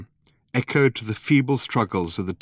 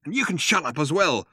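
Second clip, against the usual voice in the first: higher, irritated retort; snapped; 7 semitones higher than usual. A narrator's reading. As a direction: snap at the noisy guard. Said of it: "snapped Ford".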